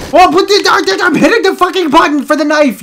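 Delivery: high, loud screaming complaint; furious shout. Real, not acted. Gamer screaming at the controls as the knife fails, furious yell. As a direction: yell at the game in real rage.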